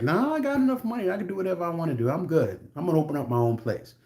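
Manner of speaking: high, fast put-on carefree voice; confident quitter. Man telling boss he'll open his own place, high and breezy. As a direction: brush it off breezily. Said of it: "He's like".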